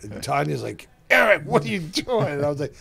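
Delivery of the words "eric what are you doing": high, louder startled scolding; a wife catching her husband doing something awkward; alarmed wife. Retold or imitated. Wife catches Eric grabbing a stranger's arm, high alarmed.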